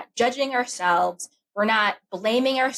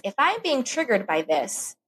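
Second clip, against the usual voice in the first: ordinary delivery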